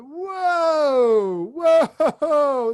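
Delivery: high, loud drawn-out whoa; imitated heckler's scream. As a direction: holler it like an outraged fan. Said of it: "it was screaming at him".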